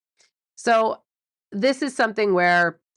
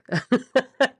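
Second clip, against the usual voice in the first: short laugh; 7 semitones higher than usual. Real, not acted. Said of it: "laughs at own fumble".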